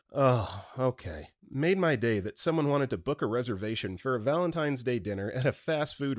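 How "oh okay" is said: understated realization, dropping on the last word; dawning realization. Acted. Caller learning there are no tables, mild realization. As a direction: let it sink in, falling away at the end. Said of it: "wide-eyed realization over the phone".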